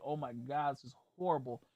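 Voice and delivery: soft, low retold dismay; dismayed aside. Watching cops talk to him, hushed dismay. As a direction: say it under your breath, sinking.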